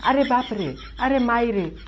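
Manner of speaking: hammed-up frightened yelling; panicked wailing. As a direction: wail in mock terror as if being beaten. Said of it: "के आवाज़ निकाले लगल".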